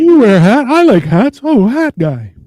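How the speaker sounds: loud, high, excited pestering; over-eager stranger. Retold or imitated. Stranger accosting a man in a hat, over-eager. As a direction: pipe up like an overly friendly stranger.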